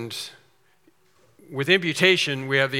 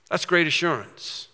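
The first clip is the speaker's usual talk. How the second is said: normal talking voice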